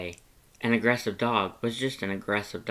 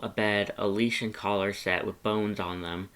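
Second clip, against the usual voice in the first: usual speaking voice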